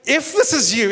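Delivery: usual speaking voice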